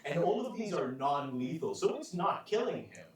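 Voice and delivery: ordinary talk, nothing marked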